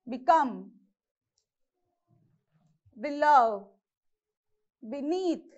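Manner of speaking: Become, below, beneath as beCOME, beLOW, beNEATH; second-syllable stress. Shown as a demonstration. Teacher showing correct English pronunciation, second-syllable stress. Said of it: "this is how you have to pronounce correct English".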